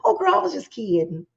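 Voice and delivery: high, sugary fake-friend voice mocking her excuse.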